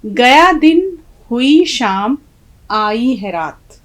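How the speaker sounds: slow, drawn-out reading of the verse; slow poem recitation. Shown as a demonstration. Teacher reciting the poem's first line to students, slow and measured. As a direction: savour each word of the couplet. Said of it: "पहली line है".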